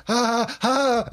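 high, forced ha-ha-ha; kids' fake laugh. Dad imitating both kids laughing at him, fake laugh. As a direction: imitate children laughing falsely on a high note. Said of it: "They both go".

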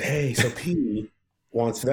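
slow stoic delivery, breaking into a laugh. Retold or imitated. A Prince person calling the booker, lightly stoic. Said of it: "stoic voice".

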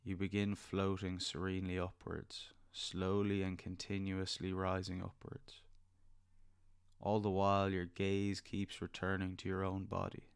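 soft spa whisper. Acted. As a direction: gently lead them into calm. Leads a guided meditation.

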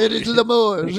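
put-on loud hammy French accent; grand mock French announcement; french chef. French joke continues.

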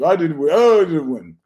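loud, high-pitched imitated complaint; mock protest. Retelling O'Malley's reaction behind the curtain, mock protest. Mimics a losing fighter's protest.

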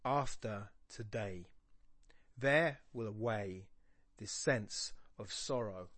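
slow, measured verse with pauses between phrases; metrical reading. Shown as a demonstration. Teacher showing students the meter, slow measured reading. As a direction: pick out the beats in "Oh, sweet tomorrow", slowly. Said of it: "let's have a look at the meter".

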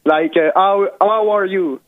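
ordinary talk

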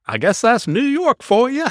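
high-pitched mock Alabama accent; hammed-up Southern cowboy accent. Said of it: "this guy in the cowboy hat".

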